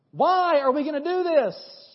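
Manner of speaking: normal talking voice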